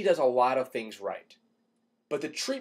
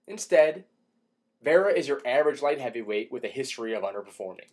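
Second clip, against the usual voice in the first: ordinary talk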